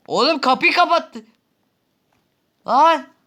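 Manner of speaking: high, loud panicked shouting; panicked yell. Real, not acted. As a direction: scream in panic at the door. Panic while chased.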